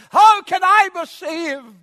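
loud, high, drawn-out cry; impassioned shouted question. Acted. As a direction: cry it out slowly at full preaching force. Acts someone asking aloud.

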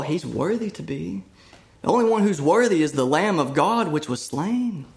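fervent pulpit preaching, pitch climbing; preaching at full force. Acted. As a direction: build the exaltation, lifting each phrase. Preaching climax.

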